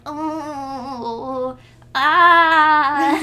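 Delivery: mock-agonised cry, building loud; anguished wail. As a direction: groan swelling into a loud wail. Deku wails and runs away.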